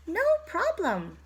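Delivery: plain reading voice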